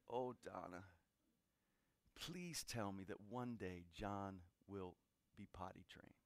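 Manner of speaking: tearful plea. Retold or imitated. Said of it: "she just burst into tears".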